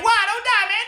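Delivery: very high, loud cry; anguished shout; distressed plea. Retold or imitated. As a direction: beg him not to die. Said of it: "I was like".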